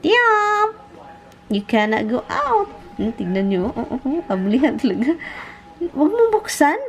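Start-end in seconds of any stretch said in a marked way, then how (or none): 0.1-0.7 high cooing call of the name; sweet high call to the dog. Real, not acted. 5.8-6.8 high mock scolding coo; high playful warning. Real, not acted. Scolding dog in pet voice.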